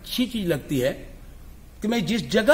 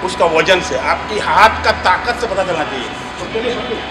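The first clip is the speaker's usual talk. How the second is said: louder, commanding dance-teacher voice; loud class instruction; 6 semitones higher, 8 dB louder than usual. Real, not acted. Chhau teacher instructing students on sword and stance, loud and commanding. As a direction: project to the whole hall as you correct them.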